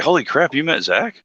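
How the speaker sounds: high, astonished outburst; jokingly gasping at the story twist; mock amazement. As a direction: act blown away by the news. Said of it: "I was like".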